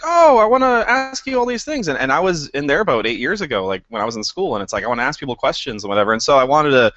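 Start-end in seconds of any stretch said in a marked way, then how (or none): 0.0-1.9 high, eager student voice; imitating an eager fan. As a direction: gush like a starstruck student.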